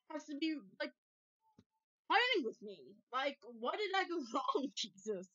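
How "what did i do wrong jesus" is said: ordinary talk, nothing marked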